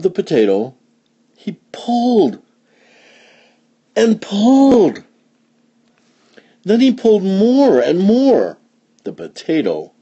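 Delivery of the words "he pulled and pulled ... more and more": high, stretched "pulled" as if heaving; effortful, high narration; straining storytelling. A narrator's reading. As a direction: heave on each pull, pitched way up.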